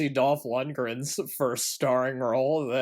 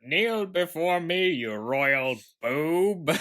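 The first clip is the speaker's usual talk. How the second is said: slow, higher villain voice; cold rasping evil emperor; 6 semitones higher, 4 dB louder, slower than usual. Pretend. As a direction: play a hammy tyrant demanding a bow. Skeletor meets the president, Superman II.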